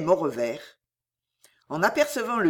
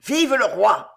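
loud raised cheer; shouting; 3 semitones higher, 6 dB louder than usual. A narrator's reading. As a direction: cry it out to the crowd. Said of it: "il cria pour la deuxième fois".

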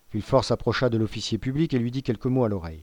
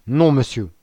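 loud, emphatic no; brisk refusal; 3 semitones higher, 7 dB louder than usual. A narrator's reading. Magistrate stopping the prisoner from calling help, sharply. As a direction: cut him off firmly and loudly. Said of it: "dit Villefort en se levant vivement".

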